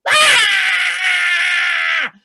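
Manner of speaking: sudden loud high yell; startled yell. Acted. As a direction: jolt awake with a cry. Waking up with a jolt.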